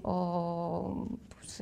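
soft whispered demo of a secret; hushed, confiding whisper. Shown as a demonstration. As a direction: drop to a whisper as if sharing a secret. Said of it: "pues, hablando así".

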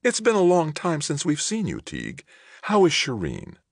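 high-pitched hostess small talk; best hostess voice. A narrator's reading. Wife asking the mayor after Shireen, hostess voice. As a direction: play the gracious hostess, pitched well up. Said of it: "in her best hostess voice".

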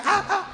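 put-on high yelps of a kid being whipped; yelping kid. Kid crying out during a whipping, high yelps. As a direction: yelp sharply as the belt lands. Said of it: "you're going".

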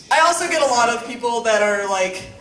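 normal talking voice

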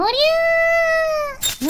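long drawn-out high yell; battle cry. Acted. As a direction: stretch the war cry out. Diving blade attack.

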